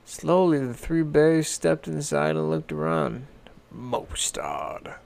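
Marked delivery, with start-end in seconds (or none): 3.7-4.9 Papa Bear whispering, lower; hushed whisper. A narrator's reading. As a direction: murmur it warily as you look round. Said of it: "said Papa Bear".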